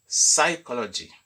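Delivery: "psychology" said the English way, sai-ko-lo-gy, silent p; English pronunciation. Shown as a demonstration. French teacher to online pupils, showing English "psychology" with silent p. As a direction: say "psychology" as saikology, no p sound. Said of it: "en anglais se dit".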